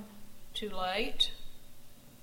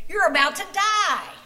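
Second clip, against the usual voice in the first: high, loud rebuke, slowing at the end; yelling; 6 semitones higher, 5 dB louder than usual. A narrator's reading. Joachim yelling at Korath to leave Jesus alone, loud and high. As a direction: shout him down, higher and louder. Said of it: "Joachim yelled at him".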